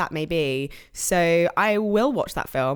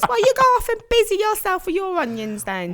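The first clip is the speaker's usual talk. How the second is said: higher, mock-scolding Cockney; EastEnders voice; 13 semitones higher than usual. Finishing the EastEnders skit, high mock-scolding Cockney. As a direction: tell them off like a London soap matriarch. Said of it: "something they'd say in EastEnders".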